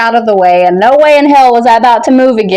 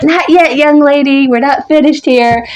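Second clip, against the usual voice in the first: higher-pitched retold telling-off; mock scolding; 6 semitones higher than usual. Director telling off a young fan, mock scolding. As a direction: scold like you would a small child. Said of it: "I got scolded like a freaking two-year-old".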